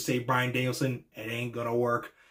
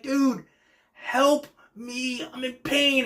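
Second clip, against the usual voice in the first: much higher, whiny plea for help; mock pleading; 11 semitones higher, much slower than usual. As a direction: beg pitifully, hammed up. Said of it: "He's like".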